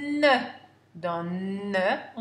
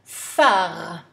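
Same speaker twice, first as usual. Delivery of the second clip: phare said much louder; syllable called out; 3 semitones lower, 8 dB louder than usual. Shown as a demonstration. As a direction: call out the syllable phare loudly. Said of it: "Troisième syllabe".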